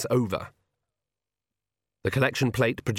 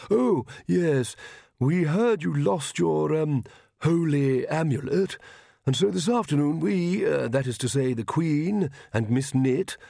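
slow, higher-pitched awkward royal; hesitant king; 5 semitones higher, slower than usual. Acted. King presents a gift to the preacher, awkward and halting.

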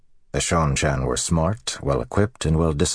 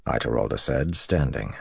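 plain reading voice

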